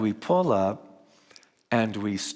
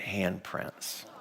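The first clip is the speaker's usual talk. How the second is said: whisper; 3 semitones lower, 8 dB quieter than usual. A narrator's reading. Hushed reveal of mud handprints.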